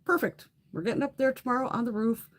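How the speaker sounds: sarcastic "perfect". Retold or imitated. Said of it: "so I'm like".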